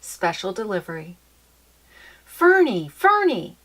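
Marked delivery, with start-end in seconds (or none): 2.2-3.5 high, loud excited calling; wild excitement. Acted. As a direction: call her name bursting with excitement. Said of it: "Papa called in wild excitement".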